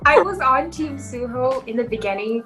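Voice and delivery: normal talking voice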